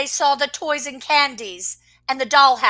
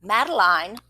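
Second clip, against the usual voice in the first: ordinary delivery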